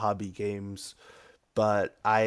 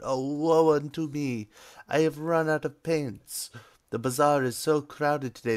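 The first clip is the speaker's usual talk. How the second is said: put-on hammy Italian accent, higher and woeful; cheesy Italian accent; 4 semitones higher than usual. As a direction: ham up an Italian master's lament. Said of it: "That was about as cheesy as it gets".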